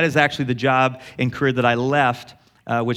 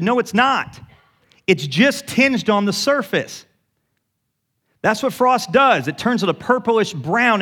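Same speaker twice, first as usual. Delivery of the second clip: emphatic reassurance; 7 semitones higher than usual. Retold or imitated. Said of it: "I said".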